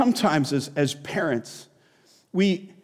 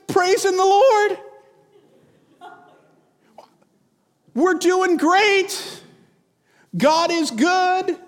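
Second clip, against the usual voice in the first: much higher, slow, sing-song fake cheer; fake cheerful; 11 semitones higher, slower than usual. Member hides falling apart from the elder, fake bright. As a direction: paper over the cracks with a bright church smile. Faking fine at church.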